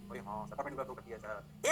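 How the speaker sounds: low, soft voice of a student; student agreeing. Acted. Student answering the teacher about the proof, low and subdued.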